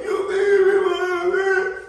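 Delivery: put-on high, loud wailing kid threatening to tell; crying little kid. Kid to bully, crying loud and high. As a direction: bawl it out like an eight-year-old. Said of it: "I started crying".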